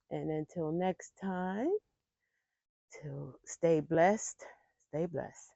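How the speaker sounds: warm. Real, not acted. Soft slow sign-off.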